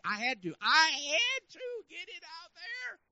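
high, drawn-out straining yell; strained shout. Acted. Preacher acts out straining to get his faith up, strained shout. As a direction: push it out with huge effort, very loud and high.

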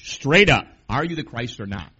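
sudden loud challenge; demanding shout. Retold or imitated. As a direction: bark the demand at him. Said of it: "what they're asking is this".

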